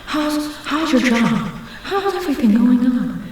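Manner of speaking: high-pitched put-on chit-chat; mock small talk. Imitating people making normal small talk, high mock voice. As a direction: pitch it way up, falling at the ends. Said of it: "talking about something completely normal".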